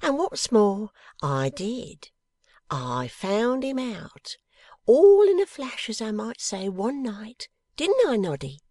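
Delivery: high, excited crowing with big swoops; gleeful motherly woman, proud of herself; Mrs Boffin, triumphant. Acted. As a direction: boast of the discovery, bursting upward. Mrs Boffin crows over her discovery.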